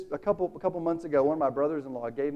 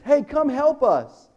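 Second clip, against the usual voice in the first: loud, high, slow call across the water; 8 semitones higher, 8 dB louder, slower than usual. Acted. Fishermen shouting to partners with breaking nets, loud and high. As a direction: hail the other boat for help.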